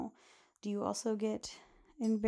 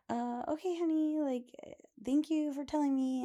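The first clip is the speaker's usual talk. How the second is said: higher, sing-song flustered mother; awkward mom; 7 semitones higher than usual. Retold or imitated. Host imitates a flustered mom answering her daughter, higher. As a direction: mimic a mom trying to stay calm.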